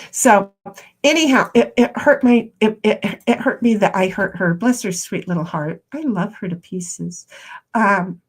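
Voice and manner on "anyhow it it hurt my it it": ordinary talk, nothing marked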